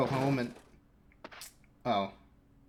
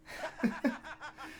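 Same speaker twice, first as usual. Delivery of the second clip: soft laugh; 12 semitones higher, 8 dB quieter than usual. Real, not acted. Laughs at fake brand truck.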